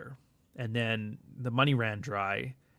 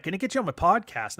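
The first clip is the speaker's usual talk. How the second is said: higher-pitched, eager mock Irish voice; mock Irish accent; 6 semitones higher, 3 dB louder than usual. Irish podcaster inviting a newfie on, mock Irish accent. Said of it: "a bunch of Irish podcasts".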